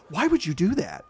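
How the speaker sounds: lightly voiced calm indignation; mock calm Cruise scolding; imitated Tom Cruise. Retelling Cruise scolding the prankster, mild mock calm. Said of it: "super calmly and super kinda like Patrick".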